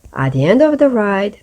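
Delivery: usual speaking voice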